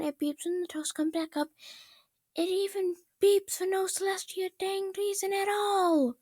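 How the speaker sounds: high, rising rant, each complaint angrier; exasperated princess. Acted. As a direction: Twilight ranting to Rainbow Dash about her toaster, high and exasperated.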